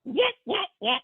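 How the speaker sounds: very high comic yelps; Curly from Three Stooges. Retold or imitated. Friend doing a Stooges routine in excitement, imitated yelping. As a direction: do short, high slapstick bursts. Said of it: "Three Stooges curly thing".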